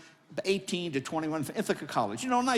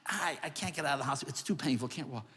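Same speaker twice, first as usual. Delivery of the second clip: usual speaking voice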